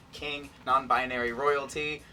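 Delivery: ordinary delivery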